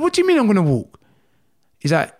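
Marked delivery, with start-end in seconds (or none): 0.0-1.0 disbelief. Retold or imitated. Retold disbelief at doctor.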